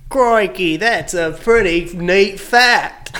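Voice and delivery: high, drawn-out Australian "crikey" voice; mock Australian accent. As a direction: exclaim like a hammy Australian wildlife host. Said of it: "respond to the fact and be like".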